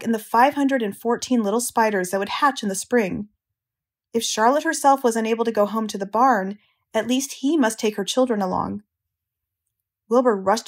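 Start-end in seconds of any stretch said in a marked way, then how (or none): none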